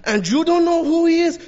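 very high, loud mock outrage; shocked woman. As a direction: imitate her utter disbelief at full pitch. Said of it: "She was shocked".

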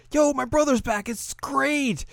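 very high, gleeful squeal; mock excited girl. Little girl telling friend brother is back, high excited voice. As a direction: pipe it up in a high child's voice.

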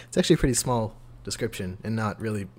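usual speaking voice

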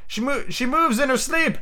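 high, breathless-with-news exclamation; excited. Acted. A boy telling Peter that Wendy stirred, excited. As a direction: blurt out the discovery in a high voice.

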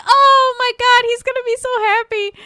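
overjoyed. Retold or imitated. Wife thanks crying husband for taking dad in, mock gushing. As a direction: gush it, imitating her delight. Wife's overjoyed reply acted out.

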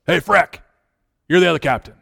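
lightly voiced coach calling a boy out; a hint of a barking gym teacher. Gym teacher appointing an unlikely captain, lightly imitated coach call. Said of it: "he looks at me and he goes".

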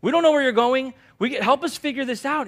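high, loud desperate plea; Thomas pleading. Retold or imitated. Preacher voicing Thomas begging Jesus for the way, high and loud. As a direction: beg for help, pitched high and loud. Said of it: "Thomas is like".

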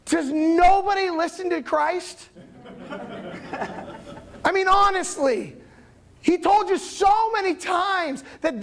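emphatic acted exasperation; exasperated angels. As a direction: scold slowly, as if shaking your head. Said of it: "angels sitting there just shaking their head".

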